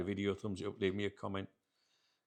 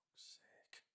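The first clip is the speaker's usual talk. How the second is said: whisper; 24 dB quieter than usual. Real, not acted. Frustrated aside to himself.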